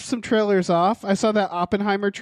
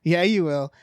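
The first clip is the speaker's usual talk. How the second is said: ordinary talk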